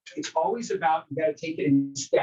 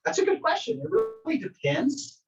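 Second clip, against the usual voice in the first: thoughtful agent reply; 13 semitones higher than usual. Retold or imitated. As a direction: stall politely before probing. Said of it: "I'm like".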